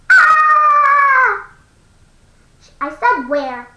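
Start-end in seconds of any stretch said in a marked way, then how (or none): 0.1-1.5 mock shriek, higher and louder; imitated scream. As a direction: imitate her scream briefly. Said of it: "She went".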